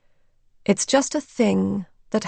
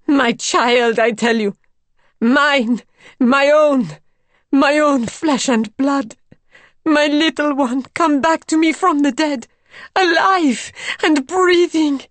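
high, loud, fervent outpouring; savage passion; 6 semitones higher, 5 dB louder than usual. A narrator's reading. Mother claiming child back from the dead, crying out passionately. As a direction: build ever higher in fierce maternal passion. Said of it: "absorbed by her own passion".

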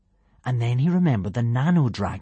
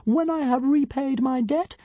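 pompous tiny dragon. A narrator's reading. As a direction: announce yourself like a pint-sized deity.

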